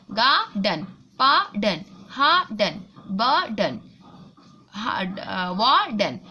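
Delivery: गार्डन, pardon, burden, warden said low with the r dropped; teacher drilling words with silent r. Shown as a demonstration. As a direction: model the word list slowly, no r. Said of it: "इसमें सब में आर silent है ना".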